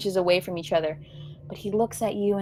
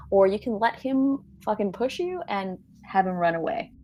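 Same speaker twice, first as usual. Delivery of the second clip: ordinary delivery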